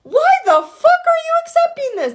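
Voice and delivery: sudden high, loud exasperated yell; fed-up outburst. Retold or imitated. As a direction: shoot up and shout it in disbelief. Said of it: "you just go".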